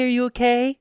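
high quick "are you okay"; mock concerned girl. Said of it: "they would have been like".

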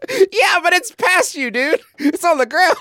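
high-pitched, exasperated comeback; indignant comic retort. Real, not acted. Player tells Griffin he missed the curveball, high indignant retort. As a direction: squeal the rebuttal in mock outrage.

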